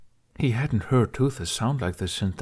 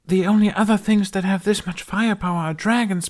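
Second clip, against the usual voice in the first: frightened; 11 semitones higher, 6 dB louder than usual. Acted. Explosions, fleeing through woods.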